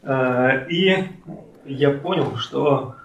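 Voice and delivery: ordinary delivery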